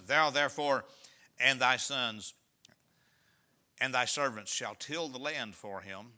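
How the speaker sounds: solemn pulpit reading; scripture reading. Acted. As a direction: read the verse out to the congregation. Reads scripture aloud.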